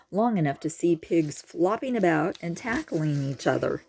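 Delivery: usual speaking voice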